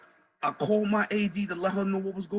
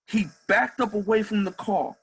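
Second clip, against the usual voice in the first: normal talking voice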